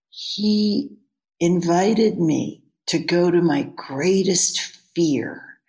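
ordinary talk

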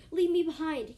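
a loud, high yell. Acted. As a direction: shout it at your friend to leave. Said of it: "Carrion yelled at you".

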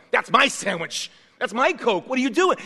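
loud, indignant mock squabble; mock quarrel over a sandwich, loud and petty; squabbling. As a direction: act out the passengers bickering. Passengers quarreling on the boat.

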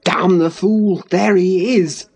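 forceful male curse; exasperated cry. A narrator's reading. Lover curses the arriving husband, exasperated cry. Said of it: "cried Heathcliff".

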